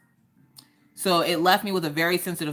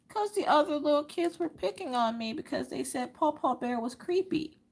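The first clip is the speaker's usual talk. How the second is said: put-on high, softer little-girl voice; imitating tearful child; 11 semitones higher, 5 dB quieter than usual. Picked-on daughter explains to mom, imitated tearful voice. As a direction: retell it as a wounded child, pitched well up. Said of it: "little tears are falling".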